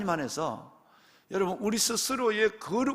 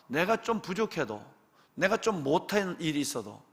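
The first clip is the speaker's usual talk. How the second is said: ordinary talk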